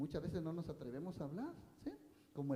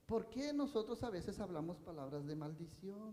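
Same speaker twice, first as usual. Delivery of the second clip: ordinary talk, nothing marked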